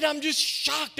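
high-pitched mock indignation; retold indignation. Shocked director complains to the pastor, high-pitched mock indignation. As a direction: imitate a shocked, angry woman. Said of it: "came in my office so mad".

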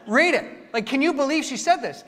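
loud, high-pitched disbelief; acted indignation. Young pastor showing senior pastor the email, indignant. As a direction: replay being worked up and affronted. Acts out his outrage to pastor.

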